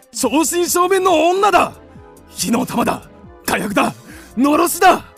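rapturous, punchy exclamations; excited admiration. Acted. Man raving about the fiery woman, excited. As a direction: pile up the praise with mounting excitement.

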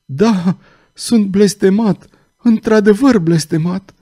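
the cursed man admits his doom; despairing confession. Acted. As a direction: give in to despair.

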